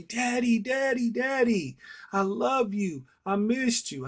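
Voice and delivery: put-on high, loud excited toddler calling Daddy; little son's voice. Toddler runs to his dad at daycare, high excited child voice. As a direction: squeal it like a small boy greeting his dad.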